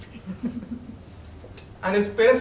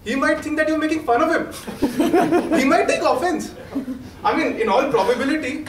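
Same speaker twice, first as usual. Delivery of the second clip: laughing; 5 semitones higher, 3 dB louder than usual. Real, not acted. Laughing at own joke.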